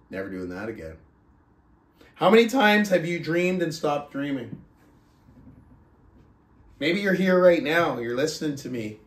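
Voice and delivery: normal talking voice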